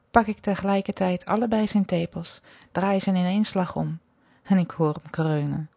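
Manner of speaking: usual speaking voice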